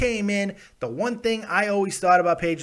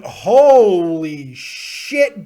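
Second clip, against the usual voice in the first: stunned; 9 dB louder, much slower than usual. Real, not acted. Shocked by fifty dollar donation.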